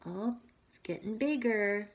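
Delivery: usual speaking voice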